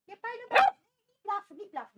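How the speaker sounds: high, loud telling-off; loud scold. Real, not acted. Owner scolds the barking dog, loud and high.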